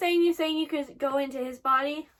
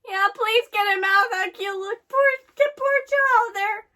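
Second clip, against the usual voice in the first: pleading; 4 semitones higher, 5 dB louder than usual. Real, not acted. Pleads for character's rescue.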